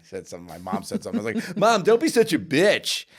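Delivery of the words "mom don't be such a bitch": high, loud, drawn-out sass; sassy mouth. Retold or imitated. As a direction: retell a mouthy kid sassing mom. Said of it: "when I was getting my sassy mouth".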